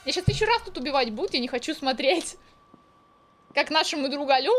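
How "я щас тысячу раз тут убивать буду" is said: normal talking voice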